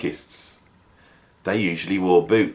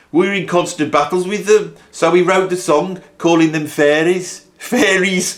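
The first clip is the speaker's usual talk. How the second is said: higher, half-laughing Brummie impression; a light Birmingham accent, cracking up; 8 semitones higher than usual. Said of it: "I can't do the accent 'cause it's making me laugh".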